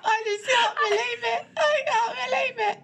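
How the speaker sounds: high, helpless laughter near tears; can't stop laughing; crying with laughter. Real, not acted. Friend laughing helplessly at the curry order, near tears. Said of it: "I'm crying".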